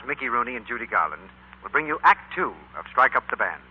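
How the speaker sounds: usual speaking voice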